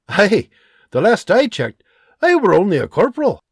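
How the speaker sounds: dry, half-joking reply; hint of a Scottish frontiersman; lightly voiced Scottish sergeant. John explains.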